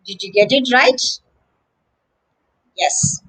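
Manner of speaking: ordinary delivery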